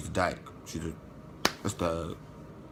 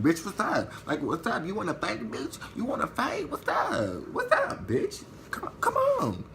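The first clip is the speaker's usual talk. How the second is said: starts higher, then climbs far higher into a laughing squeal; manic taunting; 11 semitones higher, 4 dB quieter than usual. Retold or imitated. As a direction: build from a cocky dare to a giddy, crazed shriek. Taunting, smiling crazy, building.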